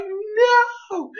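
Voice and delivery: high, wailing no; crying scream. Retold or imitated. Reviewer reacting as Happy blows up, crying scream. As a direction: wail as the explosion hits. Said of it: "and I'm like".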